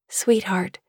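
plain reading voice

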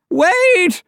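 put-on very high, loud old-woman screech; crying out. A narrator's reading. Old woman stopping the police, crying out. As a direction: cry out at the top of the range. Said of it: "cried the old woman".

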